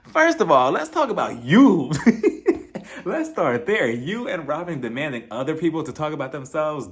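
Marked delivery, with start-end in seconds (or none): 0.0-3.9 louder, higher, half-laughing callout; calling her out. Real, not acted. Reviewer calling out a castmate to viewers, louder and laughing. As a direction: put her on the spot with a laugh.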